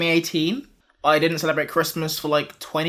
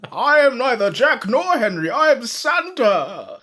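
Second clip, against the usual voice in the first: Dead Santa voice; 6 semitones higher than usual. Acted. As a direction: announce yourself as a ghostly Santa. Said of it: "He sounds like the ghost of Santa Claus".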